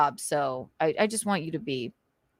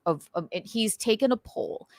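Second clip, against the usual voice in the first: ordinary delivery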